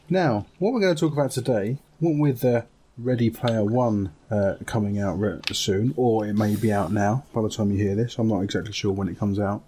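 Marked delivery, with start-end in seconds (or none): none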